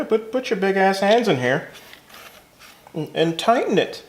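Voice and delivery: high, put-on voice imitating the instructions; mock the instructions in a high voice. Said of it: "It's like, yeah".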